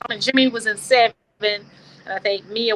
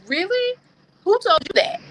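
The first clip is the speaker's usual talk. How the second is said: incredulous; 10 semitones higher than usual. Real, not acted. Mock disbelief at critics.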